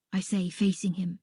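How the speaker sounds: usual speaking voice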